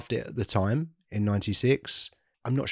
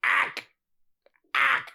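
high, strained alien shriek; pterodactyl shriek. Shown as a demonstration. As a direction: screech the ack from the throat. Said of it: "You kinda sound like a pterodactyl now".

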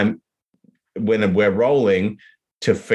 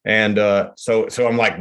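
normal talking voice

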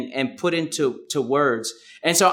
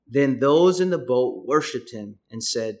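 ordinary delivery

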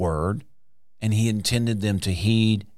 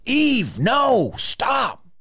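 urgent call to stop; much slower than usual. Pretend. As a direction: call out sharply to stop her. God imagined stopping Eve.

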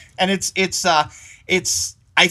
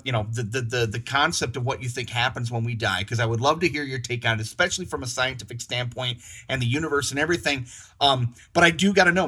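usual speaking voice

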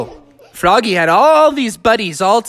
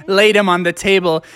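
plain reading voice

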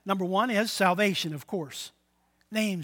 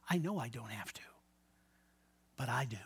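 hushed; 9 semitones lower, 13 dB quieter, faster than usual. Retold or imitated. Mother's quiet reply retold.

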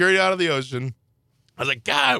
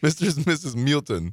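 Spanish pronunciation. Retold or imitated. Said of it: "the I in Spanish".

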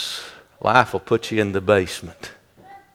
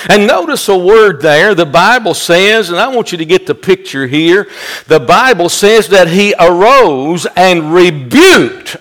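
higher, louder preacher's build-up on rebuked; fiery preaching; 6 semitones higher, 5 dB louder than usual. Acted. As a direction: preach it hard and lift the room. Preaching at full force.

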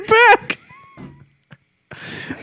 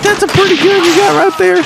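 high hammed-up cowboy line; mock cowboy voice; 14 semitones higher than usual. Retelling the cowboy's taunt to friend, high mock voice. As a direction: tease him high and drawn.

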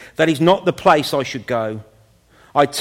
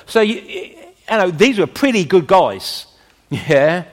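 usual speaking voice

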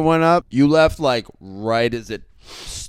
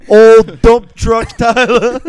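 mocking; 6 semitones higher, 10 dB louder, much slower than usual. Real, not acted. Mocking friend with nickname.

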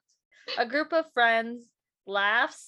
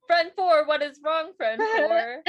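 pleading friend; 5 semitones higher, 4 dB louder than usual. Acted.

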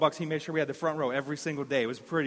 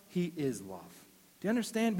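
ordinary talk

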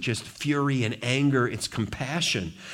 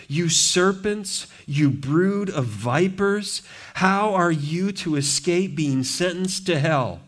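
slow, raised, deliberate condemnation; slow pulpit rebuke; slow preaching rebuke; 5 semitones higher, slower than usual. Acted. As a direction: drag out each insult.

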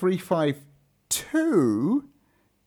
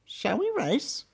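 trickster tale voices; 9 semitones higher, 5 dB quieter, slower than usual. Acted. Tortoise challenge in fable.

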